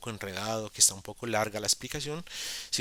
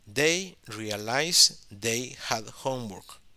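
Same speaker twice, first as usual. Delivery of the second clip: leído despacio; 3 dB louder, much slower than usual. Shown as a demonstration.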